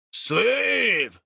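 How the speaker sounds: sharp high shout. A narrator's reading. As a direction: bark the single word. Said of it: "a voice shouted".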